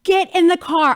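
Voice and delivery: high, loud urgent order; urgent command. Retold or imitated. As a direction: snap her into action. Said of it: "I was like".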